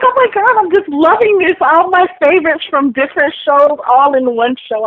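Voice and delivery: high-pitched fan gushing; retold squeal of delight, easing down; excited gushing. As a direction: gush in a high voice, thrilled. Retells own delight at the cast.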